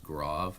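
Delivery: grave with an open AH, "grahv"; French-style pronunciation. Shown as a demonstration. Teacher correcting the pronunciation of an accent name, grave as "grahv". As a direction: say grave to rhyme with "suave". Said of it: "it's actually pronounced grave".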